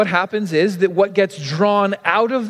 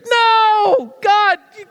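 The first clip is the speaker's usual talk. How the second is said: mock outrage; 12 semitones higher, much slower than usual. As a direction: squeal it out in mock horror at the rude request.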